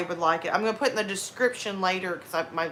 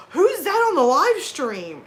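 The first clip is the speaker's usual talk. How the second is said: higher, slow baffled question; mock astonishment; 6 semitones higher, 3 dB louder, slower than usual. Viewers hearing an unfamiliar voice on stream, mock astonished. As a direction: imitate stunned viewers, stretching it out. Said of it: "everybody was just like".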